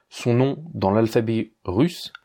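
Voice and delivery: ordinary talk, nothing marked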